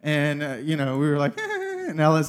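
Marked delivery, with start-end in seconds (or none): 1.4-1.9 high surprised squeak; a startled high yelp at the wrong song; mock surprise. Family reacting in the car, high startled squeak.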